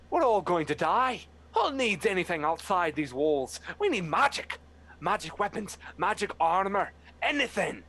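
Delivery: high, loud panicked cry; panicked hawk yelling. Acted. Hawk yelling at the crowd in panic. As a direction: shriek for magic weapons. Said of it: "as it's yelling out towards someone else".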